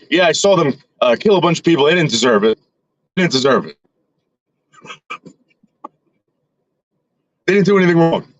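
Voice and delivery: a hint of a shaken grandfather, half-joking; imitating grandfather. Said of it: "But he's like".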